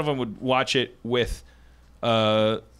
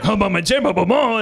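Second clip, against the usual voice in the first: louder, higher nonsense French babble; mock French chatter; 7 semitones higher, 7 dB louder than usual. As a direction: imitate a driver chatting in made-up French. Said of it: "he'd be like".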